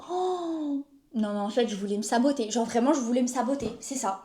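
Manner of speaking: low soft defeated mumble; quiet. Real, not acted. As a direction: mutter to yourself, beaten. Defeated muttering.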